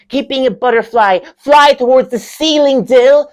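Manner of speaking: high, loud commanding drama lecturer; [name]. Acted. Lecturer pushing student to keep flying, high and insistent. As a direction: urge the student upward.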